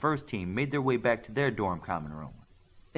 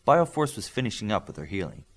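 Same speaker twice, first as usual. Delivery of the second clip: plain reading voice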